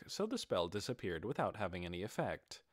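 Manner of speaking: plain reading voice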